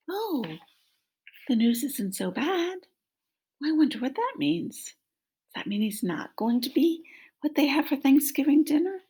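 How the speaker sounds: lightly voiced turkey hoping he's safe; hopeful turkey.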